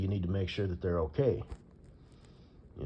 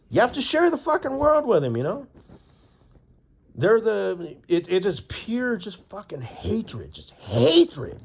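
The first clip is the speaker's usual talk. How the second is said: angry rant; 9 semitones higher, 5 dB louder than usual. Real, not acted. Ranting about hatred.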